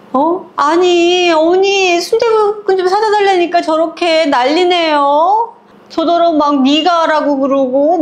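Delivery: high, wheedling tattle to mother-in-law; tattling whine. Retold or imitated. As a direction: whine to mom-in-law like a wronged child. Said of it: "완전 사뭇 다른 목소리로". (높고 애교 섞인 고자질하는 목소리)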